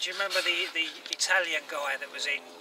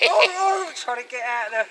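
voicing the dog; 8 semitones higher, 10 dB louder than usual.

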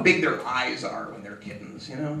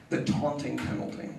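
ordinary talk

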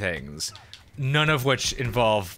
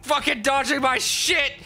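frustrated yelling; 10 semitones higher, slower than usual. Real, not acted. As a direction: yell at the dodging enemy. Frustrated yelling at dodging enemy.

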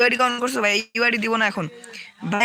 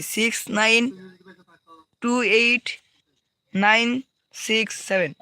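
slow, spaced-out reading of the code; slowly, digit by digit; slower than usual. Shown as a demonstration. Streamer repeats team code slowly for a viewer, slow and spaced. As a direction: spell the numbers out slowly for viewers. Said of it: "আস্তে আস্তে বলতেছি".

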